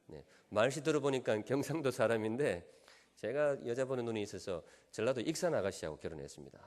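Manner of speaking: usual speaking voice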